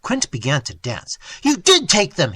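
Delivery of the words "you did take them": loud, higher yell. A narrator's reading. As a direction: yell the accusation. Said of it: "he yelled".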